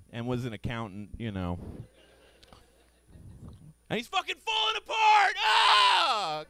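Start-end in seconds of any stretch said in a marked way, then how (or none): 3.9-6.4 fast, high, shouted outburst; mock anguish. Comedian ranting about falling-apart dad, high shout. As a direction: burst out in comic despair.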